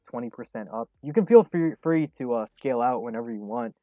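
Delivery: ordinary talk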